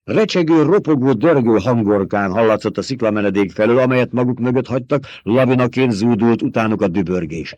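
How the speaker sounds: plain reading voice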